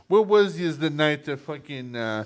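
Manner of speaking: loud mock New York mobster; New York accent. Mobster asking about the night of a killing, loud New York accent. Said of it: "trying to do New York accent".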